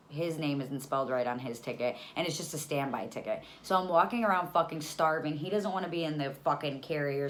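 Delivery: ordinary talk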